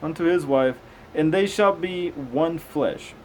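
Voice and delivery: slow, measured scripture reading. Acted. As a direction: read it out like a careful lesson.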